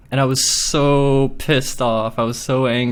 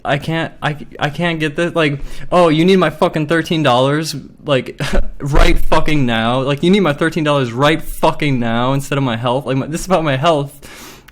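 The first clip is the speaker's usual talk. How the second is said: retold angry protest, higher pitched; heated, incredulous retelling that surges louder on the demand; getting pissed; 3 semitones higher, 4 dB louder than usual. Customer retelling an argument with the manager, getting pissed. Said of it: "I was, like, getting pissed".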